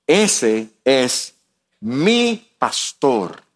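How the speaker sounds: "mi" set apart and drawn out slowly; stress on MI. Real, not acted. Preacher claiming God as his own shepherd, stress on "mi". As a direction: lean slowly on "mi" in "mi pastor". Pero es mío.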